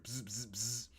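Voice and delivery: soft, short buzzing noises; imitated phone buzz.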